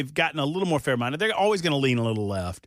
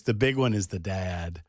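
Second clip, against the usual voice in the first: lower, slow deadpan delivery; deadpan dad line; 7 semitones lower than usual. Retold or imitated. Host quoting the ad's punchline, slow low deadpan. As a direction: drop it low and slow, dead serious. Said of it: "he goes".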